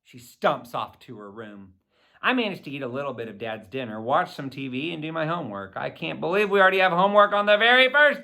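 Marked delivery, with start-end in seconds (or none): none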